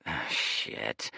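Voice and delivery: ordinary delivery, nothing marked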